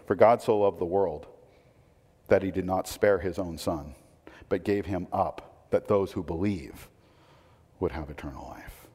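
soft, low, weighty recital; solemn sincere reading of the verse; earnest reading. Shown as a demonstration. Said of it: "Read John three sixteen this way".

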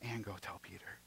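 hushed whisper, no voice. Acted. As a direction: breathe the words like a secret. Repeats Jesus' line as whisper.

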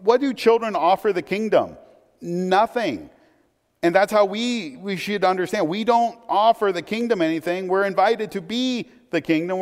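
preaching. Acted. Pastor urging the congregation about children, fervent preaching. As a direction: lift it up and press each point home.

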